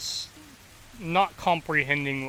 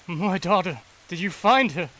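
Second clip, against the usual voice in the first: anxious plea; 5 semitones higher, 6 dB louder than usual. Acted. Silas asks anxiously for daughter.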